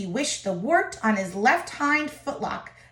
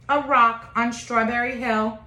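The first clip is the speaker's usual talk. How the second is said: plain reading voice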